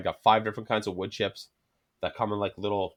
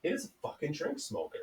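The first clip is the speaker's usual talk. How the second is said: ordinary delivery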